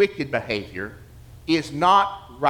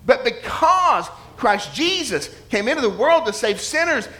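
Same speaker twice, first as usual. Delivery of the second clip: preaching at full force; 6 semitones higher than usual. Real, not acted. Preacher to congregation, rising to full impassioned force. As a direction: lift the room with the gospel.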